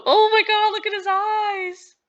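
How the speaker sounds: gasp, then high, loud squeal; thrilled shock. Real, not acted. Player reacting to his changed eyes, thrilled high squeal. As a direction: squeal with delighted shock, slowing at the end.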